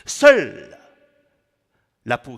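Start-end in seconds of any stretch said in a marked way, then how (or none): 0.1-0.8 loud, high attack falling away; declaimed cry. Acted. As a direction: hurl the word out as a poetic refrain. Mot martelé dans le poème. (cri déclamé, fort)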